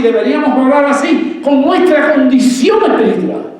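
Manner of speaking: loud, high, driving sermon voice; a pastor raising the voice on rival wives; fervent preaching. Real, not acted. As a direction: preach it at full force to the congregation. Preacher exhorts at full force.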